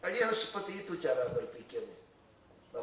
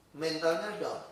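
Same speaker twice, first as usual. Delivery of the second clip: ordinary delivery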